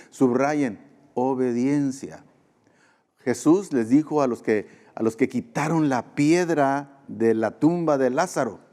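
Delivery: normal talking voice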